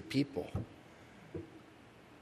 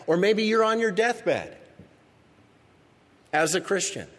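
higher, louder fervent sermon climb; impassioned preaching; 5 semitones higher, 4 dB louder than usual. Acted. Pastor urging struggling Christians toward hope, impassioned preaching. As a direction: build the fire of the sermon.

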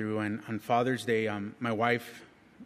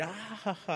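high, soft mock laugh; retold laugh; 10 semitones higher, 7 dB quieter than usual. Man laughing at the doctor's twins count, mock laugh. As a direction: imitate laughing it off, high and light. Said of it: "I'm laughing".

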